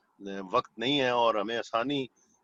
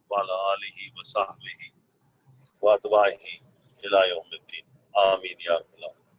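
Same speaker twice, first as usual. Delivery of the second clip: prayer; 5 semitones lower than usual. Acted. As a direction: recite it as a devout closing prayer. Leads a closing supplication.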